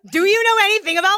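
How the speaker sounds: high, fast spooky ghost voice; eerie ghost child wail. Acted. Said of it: "You heard him".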